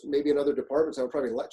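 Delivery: normal talking voice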